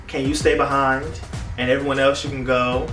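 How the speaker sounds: ordinary talk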